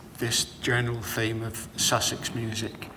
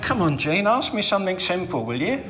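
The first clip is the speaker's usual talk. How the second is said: exasperated plea; 7 semitones higher, 4 dB louder than usual. Retold or imitated. Exasperated reply on ladder.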